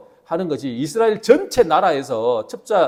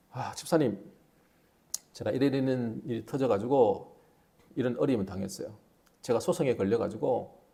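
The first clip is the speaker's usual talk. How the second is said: woeful complaint; 6 semitones lower, 7 dB quieter than usual. Acted. Church member tells troubles.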